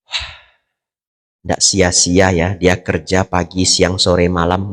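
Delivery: soft, low, appreciative murmur; genuinely pleased for the neighbour; quiet admiration. Pretend. Admiring neighbour's new car. (kagum dengan suara pelan dan tulus)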